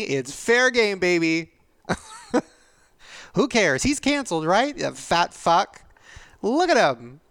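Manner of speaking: high, loud jeering taunt; mock troll jeer. Voicing internet trolls mocking her weight, loud jeer. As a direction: sneer it like a cruel internet commenter.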